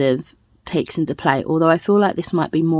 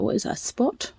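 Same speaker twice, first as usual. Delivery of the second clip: whispered aside; 5 semitones higher, 9 dB quieter than usual. Real, not acted. Aside to her pet.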